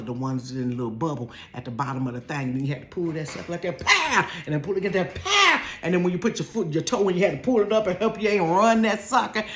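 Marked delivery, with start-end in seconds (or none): 3.8-4.3 high punchy mock snap; pow sound effect. Showing how knee highs snapped, punchy pow. 5.1-5.6 high punchy mock snap; pow sound effect. Showing how knee highs snapped, punchy pow.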